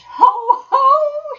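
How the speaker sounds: very high, loud gleeful crowing; whooping, triumphant laugh-cry; ecstatic self-admiration. A narrator's reading. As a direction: crow with delight at your own cleverness. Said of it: "he cried in ecstasies of self-admiration".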